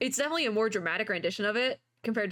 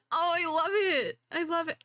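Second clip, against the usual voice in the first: gushing delight; 7 semitones higher than usual. Real, not acted. Fan adoring the animation, high gushing.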